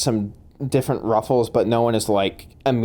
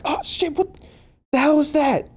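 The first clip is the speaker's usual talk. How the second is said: high, startled yelp of a voice. Acted. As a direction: act out a hurt, startled thug, pitched way up. Startled attacker voiced by game master.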